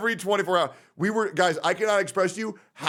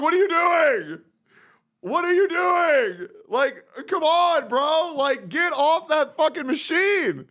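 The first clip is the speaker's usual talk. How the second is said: much higher, slowed, pleading whine; exasperated mock plea; 3 dB louder, slower than usual. Streamer retelling begging his friend to leave the game, high mock whine. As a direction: whine at him to get off the computer. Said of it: "I'm just like".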